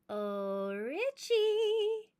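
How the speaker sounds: high, sweet cooing, rising on Richie; a sugary little coo. Acted. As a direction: coo to butter him up. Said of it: "I cooed".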